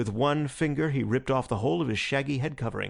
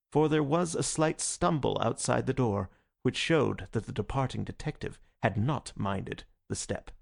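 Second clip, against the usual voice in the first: ordinary delivery, nothing marked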